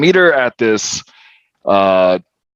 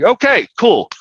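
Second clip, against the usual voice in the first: high-pitched, jumping "fine by me" tone; mock agreeable brush-off; 13 semitones higher than usual. Man brushing off his furious date, mock agreeable. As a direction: toss off a much higher, exaggerated acceptance. Retold brush-off as she rejects him.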